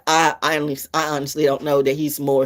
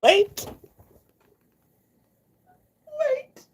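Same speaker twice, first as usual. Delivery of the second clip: much higher, wheezing laugh words. Real, not acted. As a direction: speak through a laughing fit. Laughing fit.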